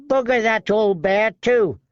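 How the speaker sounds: thin sharp man mocking; Lark sneering. A narrator's reading.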